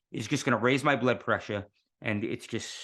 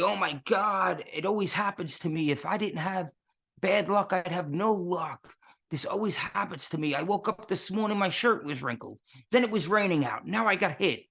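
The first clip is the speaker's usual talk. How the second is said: higher, moaning self-pity over bad luck; whiny victim; 7 semitones higher than usual. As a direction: whine like everything always goes wrong for you. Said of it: "that victim mentality".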